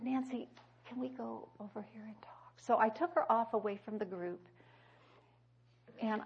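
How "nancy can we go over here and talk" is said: soft breathy aside, barely voiced; hushed whisper. Woman quietly asks a crying friend to step aside, whispering. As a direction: whisper it close, just for her.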